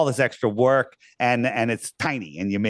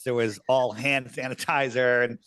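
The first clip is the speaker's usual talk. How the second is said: normal talking voice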